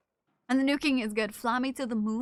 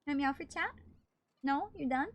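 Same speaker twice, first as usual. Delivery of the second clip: ordinary talk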